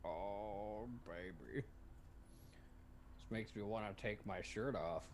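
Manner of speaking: low, hushed bedroom voice; slow, soft, hammed-up sultry purr; mock seductive. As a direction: play turned on by the pour, very low and slow. Mock-seductive reaction to beer pour.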